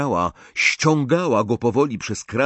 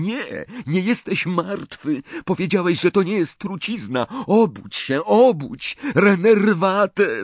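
desperate calling; 9 semitones higher than usual. A narrator's reading. Harry trying to revive Dumbledore, desperate calling. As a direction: shout at him to wake up. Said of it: "zawołał".

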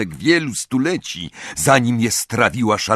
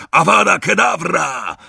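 higher, forceful incantation yell; yelled curse; 7 semitones higher, 3 dB louder than usual. A narrator's reading. Crape wheeling round to kill, yelled curse. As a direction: hurl the curse out loud. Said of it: "ponownie wrzasnął".

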